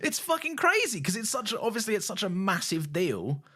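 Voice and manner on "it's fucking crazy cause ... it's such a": 1 excited. Real, not acted. Excited about the twist.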